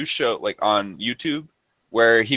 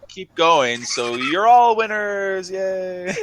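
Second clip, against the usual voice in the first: mock announcer; 9 semitones higher, slower than usual. Voicing the organizers.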